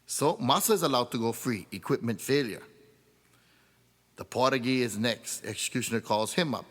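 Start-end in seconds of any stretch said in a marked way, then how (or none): none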